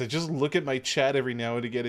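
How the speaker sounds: ordinary talk, nothing marked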